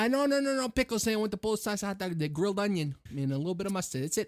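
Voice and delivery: high, excited Chicago order; Chicago accent. Acted. Ordering a Maxwell Street hot dog in a Chicago accent. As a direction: insist on no pickles, Chicago style. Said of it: "We're doing a Chicago accent".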